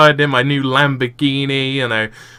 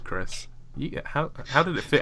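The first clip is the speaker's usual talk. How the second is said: ordinary talk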